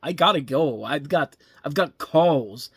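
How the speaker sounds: hammed-up halting impression, loud opening and stretched last word; mock a bad actor's odd line reading; Christopher Walken. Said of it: "he's, like, channeling Christopher Walken when he's talking like that".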